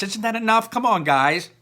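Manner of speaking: exasperated plea. Acted. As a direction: rein in a bickering class. Teacher calming a quarrel.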